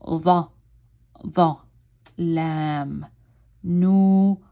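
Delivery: the, the, lam, nur broken into separate syllables. Shown as a demonstration. Teacher breaking words down for novice learners, separated syllables. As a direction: split into slow separate syllables. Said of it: "I go like".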